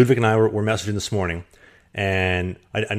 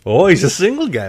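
mock excited; 8 semitones higher, 7 dB louder than usual. Teasing reveal, mock excitement.

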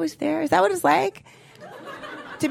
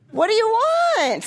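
usual speaking voice

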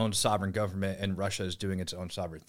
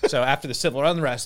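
louder, higher laugh into the restart; 6 semitones higher, 8 dB louder than usual. Real, not acted. Guest recovering after a flub, laughing. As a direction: chuckle and push on.

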